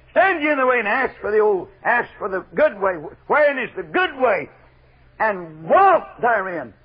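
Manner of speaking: emphatic pulpit cries with punched words; full-force preaching. Real, not acted. Preacher exhorting the burdened to pray, full-force preaching. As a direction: preach it out, rising on the key words.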